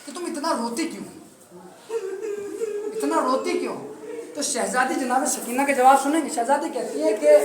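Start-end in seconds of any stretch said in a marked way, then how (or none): 0.1-3.8 hushed taunt. Retold or imitated. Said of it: "جناب سکینہ سے کہتا کہ".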